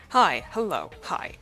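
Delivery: ordinary talk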